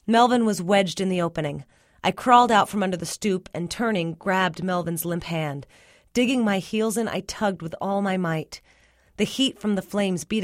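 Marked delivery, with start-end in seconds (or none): none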